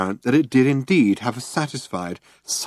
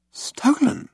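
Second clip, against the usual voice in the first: high, breathless shock; astonished. A narrator's reading. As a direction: gasp it in disbelief. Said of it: "said Ford".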